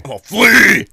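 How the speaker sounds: loud high joking cry; mock shout. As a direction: yell it in playful panic. Laughing mock dragon cry.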